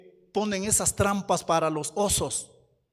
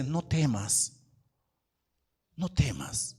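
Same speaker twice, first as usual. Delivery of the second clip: gentle reassurance; 5 semitones lower, 5 dB quieter, slower than usual. Acted. God's comforting words voiced softly.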